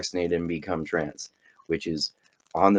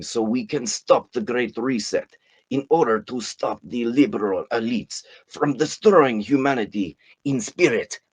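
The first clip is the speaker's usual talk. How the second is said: hammed-up Russian accent for a philosopher quote; 2 semitones higher than usual. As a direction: put on a lightly done Russian accent. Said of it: "I'm gonna try to say this in a Russian accent".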